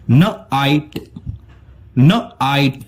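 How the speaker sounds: न आइट split into न then आइट; sounds split apart. Shown as a demonstration. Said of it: "इसका pronunciation होता है".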